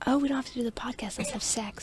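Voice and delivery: mock whispered. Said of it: "They're probably like".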